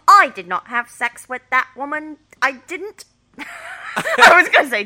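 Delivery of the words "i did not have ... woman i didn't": loud, raised Cockney take on a politician; hammed-up Cockney accent; Cockney Bill Clinton. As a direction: belt out a presidential denial in Cockney. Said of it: "Bill Clinton with a Cockney accent".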